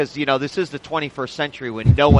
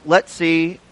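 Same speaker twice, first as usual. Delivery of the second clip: ordinary talk, nothing marked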